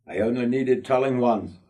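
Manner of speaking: usual speaking voice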